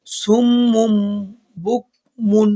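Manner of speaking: slow chanted সুমুম বুকমুন with ghunna; Quran recitation. Shown as a demonstration. Teacher reciting the next words, slow chant. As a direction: hum the mim in সুমুম. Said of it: "এবার আসেন বলবেন".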